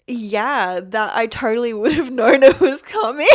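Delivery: giggling at the joke against herself; laughing sarcasm. Real, not acted. Retelling her reply to the boyfriend, laughing sarcastically.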